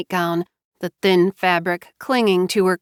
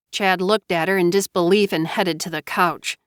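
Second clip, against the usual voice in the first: usual speaking voice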